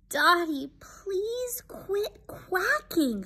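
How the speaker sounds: high, soft, drawn-out plea; quiet quail. Acted. Quail with a hurting head begs duck friend, soft high plea. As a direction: plead in a tiny bird voice. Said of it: "says Quincy".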